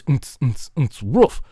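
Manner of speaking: brief thumped beat with a woof; beatbox. Shown as a demonstration. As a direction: repeat the pattern as mouth percussion. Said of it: "I said it was".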